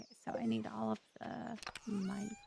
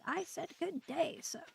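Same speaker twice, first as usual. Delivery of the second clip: mock otter; 7 semitones higher than usual. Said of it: "He's like".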